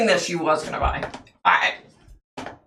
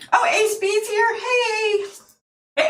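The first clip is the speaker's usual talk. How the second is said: light up and call out to a friend; a delighted shout to a viewer who just arrived; excited greeting. Real, not acted.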